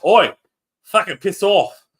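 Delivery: loud, high mock London shout; London accent. Host imitating London telling them off, mock London accent. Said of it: "the London had to say".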